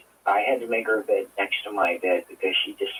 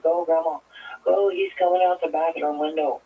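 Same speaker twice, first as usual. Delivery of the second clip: retold terrified scream; 7 semitones higher than usual. Granddaughter begging grandma to drive away, frantic. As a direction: mimic the child's frantic yell. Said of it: "She was screaming".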